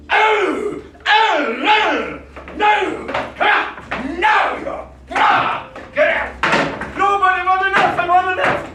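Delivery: very high, loud rage; furious screaming. Acted. Man attacking rugby boys, furious screaming. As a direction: scream it in blind fury.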